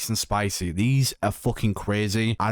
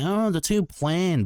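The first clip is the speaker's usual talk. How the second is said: high, slow, mocking whine; mocking whiny critic; 8 semitones higher, slower than usual. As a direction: imitate a picky hater. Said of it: "I'm like".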